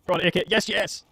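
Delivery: loud, high cheering; excited cheer. Real, not acted. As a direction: cheer the unit on loudly. Cheering his unit on.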